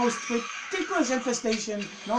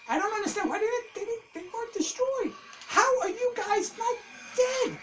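incredulous disbelief; 12 semitones higher than usual. Real, not acted. Zombies survived the dynamite.

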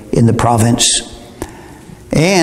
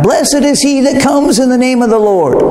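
high, ringing mock acclaim; crowd's joyful cry; 10 semitones higher than usual. Preacher voicing the Palm Sunday crowd, high mock acclaim. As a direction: lift it like a crowd hailing a king. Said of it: "They cried out".

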